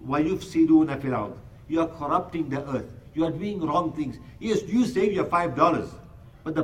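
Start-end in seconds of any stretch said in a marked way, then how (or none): 0.1-1.3 rapid chanted Arabic recitation; flowing Quranic recitation, quick and even. Acted. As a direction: recite the verse fast, in one breath. Quran recitation inside sermon.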